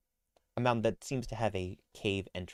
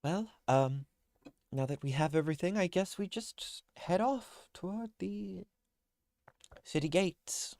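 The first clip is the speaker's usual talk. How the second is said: higher, hesitant character voice; awkward Liv; 10 semitones higher than usual. Acted. Game master voices Liv.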